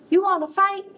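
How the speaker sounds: high, pushy challenge; high-pitched cocky bug; picking a fight. A narrator's reading. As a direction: squeak out a cocky dare. Grouchy ladybug picks a fight.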